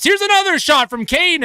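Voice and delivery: sudden high burst of urgency; excited shot call. Real, not acted. Commentator calls another shot from Kane, excited burst. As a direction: leap up as the shot comes.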